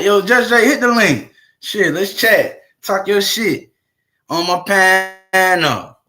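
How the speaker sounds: loud, high, drawn-out invitation; calling out. Real, not acted. Streamer calling Just Jay to join the live, loud slow call. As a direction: call him over, stretch it slow.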